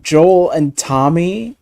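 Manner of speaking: ordinary talk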